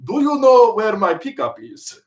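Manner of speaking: slow Southern drawl with Russian tinge; cowboy twang over Russian; Russian Southern accent. Shown as a demonstration. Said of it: "Russian Southern accent would sound like".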